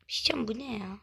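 voiceless whisper of disbelief. Real, not acted. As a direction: whisper it as if afraid to say it aloud. Reacts to bad reward. (inanamayarak fısıldama)

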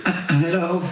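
put-on hurt baby's stammered hello; קול של תינוק. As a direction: squeak out hello like a kicked baby. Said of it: "יצא לי קול של תינוק שנתנו לו בעיטה בראש". (הלו בקול תינוק חבוט)

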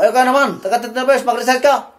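hammed-up angry teacher yell; mock scolding shout. Shown as a demonstration. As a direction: bark at the student the wrong way. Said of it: "hindi mo sisigaw mo".

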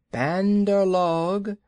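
high, slow python calling out; slow snake call. Acted. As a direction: draw the name out to hold them. Kaa calls the monkeys.

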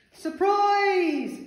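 very high, loud, stretched surprise yell; gleeful yell. A narrator's reading. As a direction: burst out as if springing from hiding. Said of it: "yelled Broccoli".

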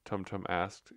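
ordinary delivery

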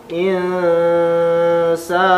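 "Insa" with the sa held long. Shown as a demonstration. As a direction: hold the "sa" out long, rising. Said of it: "Sa panjang". ("Insa" dengan sa dipanjangkan)

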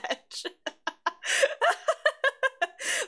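long, high giggle; real laughing fit. Real, not acted. Streamer reacting to chat joke, long high laughter.

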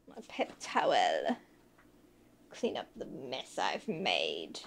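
very low, soft creaky mumble; silly put-on voice. Muttering to self while cleaning up, silly low voice. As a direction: sink right down into a low silly voice.

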